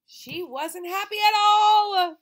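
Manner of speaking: high, loud, slow mock-crying; mock wailing. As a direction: wail it like a fake sob.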